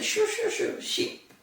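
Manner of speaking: high, mock shooing call at a sheep. Farmer chasing the sheep from the crops, mock shooing call. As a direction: shoo the sheep off like a farmer, lightly.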